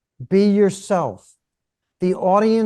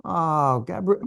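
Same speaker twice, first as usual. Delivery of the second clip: ordinary delivery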